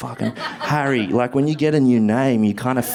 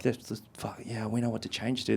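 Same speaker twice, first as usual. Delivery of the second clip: muttering teachers; 3 semitones lower, 13 dB quieter, faster than usual. Retold or imitated. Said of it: "teachers were like".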